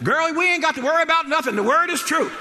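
very high, loud, fast gleeful mock voice; imitating mama on phone. Mama shouting joy to her girlfriend on the phone. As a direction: crow it to a girlfriend. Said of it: "on the phone talking to her girlfriend".

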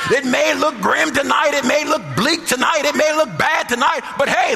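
high, sustained, shouted sermon delivery; full-force preaching. Real, not acted. Preacher rousing the congregation to confidence, fervent high-pitched preaching. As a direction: ring it out in rousing, driving phrases.

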